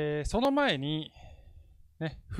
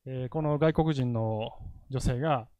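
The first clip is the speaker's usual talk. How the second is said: normal talking voice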